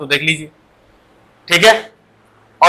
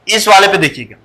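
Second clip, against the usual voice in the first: normal talking voice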